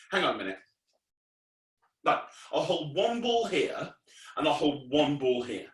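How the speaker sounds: ordinary talk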